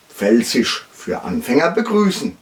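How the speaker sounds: usual speaking voice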